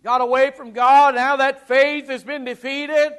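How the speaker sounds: loud, high, impassioned pulpit voice; full-force preaching. Acted. Preacher thundering to the congregation, full-force preaching. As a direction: preach it out to the back pews.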